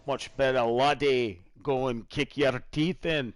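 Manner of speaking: hammed-up Scottish lilt; amateur Scottish, slow on the threat; Scottish accent attempt. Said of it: "that's my attempt".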